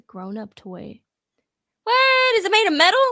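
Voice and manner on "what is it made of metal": high, loud disbelief; shocked. Real, not acted. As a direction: blurt it out in shock. Shocked at the metal toy.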